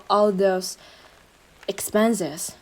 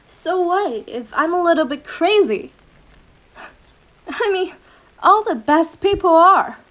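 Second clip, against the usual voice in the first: manic giggle; 6 semitones higher, 5 dB louder than usual. Acted. Lover admits being crazy, laughing.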